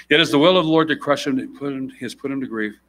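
measured formal Bible reading; scripture reading. Acted. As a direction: read the passage with weight. Reads Isaiah aloud.

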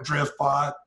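stiff mechanical robot voice. Retold or imitated. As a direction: say it like a clunky robot. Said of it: "I did a terrible robot voice".

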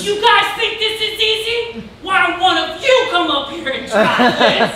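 high, loud taunting at the crowd; mock yelling. Comic on stage taunts the rock crowd, yelling. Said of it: "He started yelling at us".

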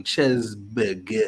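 slow, soft mock French accent. Pretend McDonald's order, mock French accent. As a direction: stretch it out in a put-on French accent.

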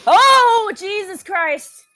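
very high, loud shriek falling away slowly; real startled scream, then drawn-out dismay; terrified yelp. Real, not acted. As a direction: scream at a jump scare. Jump scare in horror game.

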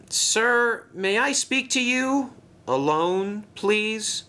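shy engine asking politely; Toby. Acted.

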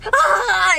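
high loud shriek; mock horror-movie yell; chainsaw scream. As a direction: let out a piercing cartoon scream. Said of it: "It goes".

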